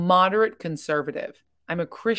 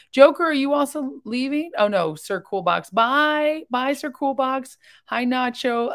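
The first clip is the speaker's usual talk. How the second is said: excited goodbyes; 7 semitones higher than usual. Real, not acted. Streamer bids viewers leaving the chat a high, cheerful bye. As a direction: wave viewers off, pitched well up.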